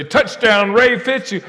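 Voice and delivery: high, loud announcer call; stadium announcer call. Retold or imitated. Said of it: "I never forget hearing them say".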